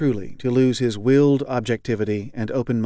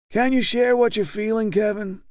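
high, blandly kind leader; 10 semitones higher than usual. A narrator's reading. Group leader gently affirms weeping Kevin, high and blandly kind. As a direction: soothe him like a patient therapist talking to a child. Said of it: "very blandly and kindly".

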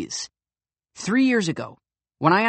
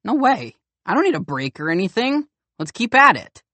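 raised, insistent refusal; eager protest; 5 semitones higher, 3 dB louder than usual. Acted. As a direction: push back hard, won't stop now. Youth refuses break, worked up.

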